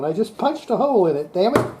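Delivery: ordinary delivery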